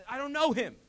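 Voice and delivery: loud, high outburst of denial; frustrated shout. Retold or imitated. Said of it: "He shouts to the people".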